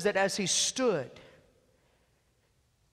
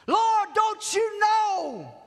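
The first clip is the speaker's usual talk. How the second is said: desperate cry; 9 semitones higher, much slower than usual. Acted. Voicing a panicked prayer.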